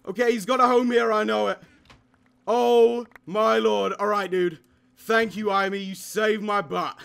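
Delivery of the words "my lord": ordinary talk, nothing marked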